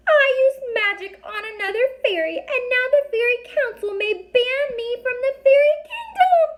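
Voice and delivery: very high, tiny, squeaky fairy voice; a little fairy snapping at strangers, high and squeaky. A narrator's reading. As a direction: speak like a fairy only inches tall. Fairy voice held.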